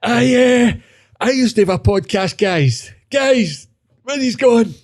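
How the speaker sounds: high-pitched mock has-been in the pub; washed-up podcaster. Old ex-podcaster calling after the young team, high mock plea. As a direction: plead after them in a high put-on voice.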